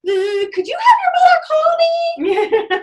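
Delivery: high, slow sing-song request; sing-song stranger. Retold or imitated. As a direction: croon it through the window. Stranger knocking on car window.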